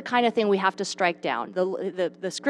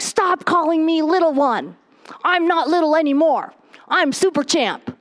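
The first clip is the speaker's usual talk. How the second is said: put-on high-pitched protesting little boy; imitating a toddler's proud outburst; indignant toddler; 7 semitones higher than usual. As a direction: protest like a small child, high and emphatic. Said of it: "he's about two and a half".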